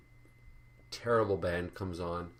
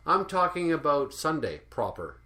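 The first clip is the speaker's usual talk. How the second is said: mocking YouTuber; 8 semitones higher, 5 dB louder than usual. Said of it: "he always uses the word proper".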